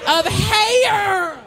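high-pitched, hammed-up rage, slowing to stretched-out words; furious comic rant. Comedian recalls threatening the balding landlady, high enraged rant. Acted quarrel with insulting landlady.

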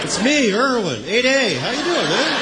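hammed-up delight at meeting the pilot again; mock excited greeting. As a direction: greet him like a long-lost best friend. Greets captain with mock enthusiasm.

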